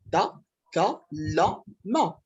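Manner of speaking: تكلم slowly split into four separate beats; syllable by syllable. Shown as a demonstration. Teacher counting syllables for students, تكلم split syllable by syllable. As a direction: break تكلم into four syllables with pauses between. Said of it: "combien de syllabes il y a?".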